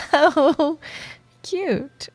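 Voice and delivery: high, amused coo; teasing coo. Acted. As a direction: coo it, fresh from laughing. Teasing a nervous coworker.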